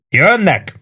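high, sudden cry; narrated warning yell; shouted warning. A narrator's reading. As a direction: call out the alarm from above. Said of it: "ordította le Warden".

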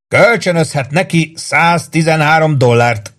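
high, slow, amused jibe; still laughing. A narrator's reading. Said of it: "mondta Warden még mindig röhögve".

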